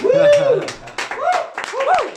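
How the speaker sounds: high, loud "woo!"; excited whoop. Real, not acted. Cheering once the word is got, excited whooping.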